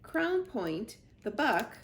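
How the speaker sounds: ordinary delivery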